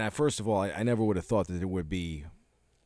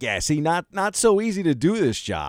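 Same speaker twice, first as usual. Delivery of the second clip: higher, louder imagined taunt; mock gloating quote; 8 semitones higher, 6 dB louder than usual. Cohost imagines the driver laughing to himself at Michael, mock gloating. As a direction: play him having a laugh at Michael. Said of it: "where he's like".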